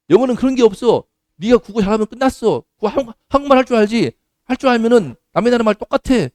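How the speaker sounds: 높고 큰 소리로 학생을 다그치는 선생; 학생에게 쓰라고 밀어붙이듯, 높고 크게; 호통치는 선생 흉내. Retold or imitated. 학생을 몰아붙이는 선생 흉내. (게으른 학생을 다그치는 선생의 높고 큰 호통)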